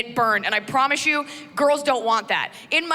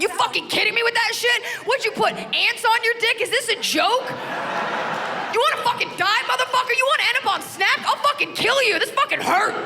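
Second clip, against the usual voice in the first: furious rant; 11 semitones higher than usual. Retold or imitated.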